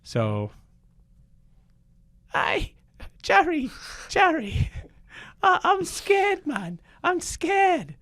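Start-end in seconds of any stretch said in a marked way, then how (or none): 2.3-7.9 very high, loud, hammed-up frightened squeal; badly faked British comedian, wailing in fear; Ricky Gervais. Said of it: "let's switch roles now".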